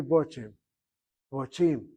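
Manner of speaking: "Botim" with a rounded "o" in the first syllable; short-kamatz pronunciation. Shown as a demonstration. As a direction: say "Botim" as baw-TEEM. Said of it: "I'll try to look into it".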